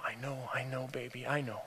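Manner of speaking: low, very soft comforting voice; tender reassurance. Acted. Father holding his daughter's face, tenderly reassuring. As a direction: soothe her gently and quietly.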